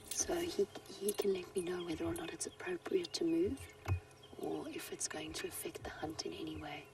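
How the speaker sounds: soft hushed safari whisper; wildlife watching whisper. Real, not acted. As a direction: keep it to a whisper near the cat. Cat watching the vehicle during its hunt.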